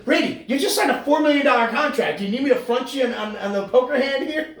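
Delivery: high, loud ribbing buddy; teasing hockey buddy at cards; mock teasing card player. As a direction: rib a rich teammate. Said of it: "he's like".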